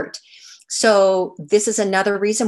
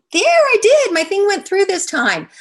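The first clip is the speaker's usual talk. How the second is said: relieved triumph; 13 semitones higher, 7 dB louder than usual. Real, not acted. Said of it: "pointer finally works".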